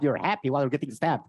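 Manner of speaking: ordinary talk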